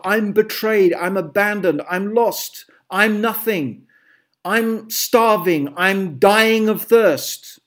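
slow, hammed-up woe; dramatic self-pity. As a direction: wail like a martyr. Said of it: "I'm being dramatic now".

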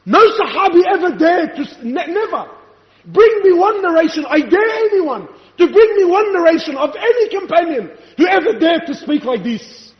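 high, forceful sermon delivery; impassioned preaching. Real, not acted. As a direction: drive each phrase home to the congregation.

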